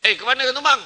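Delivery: high, loud suspicious question; wife calling after her husband leaving again, high and loud. Retold or imitated. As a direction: catch him sneaking off. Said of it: "kalau bini tegur".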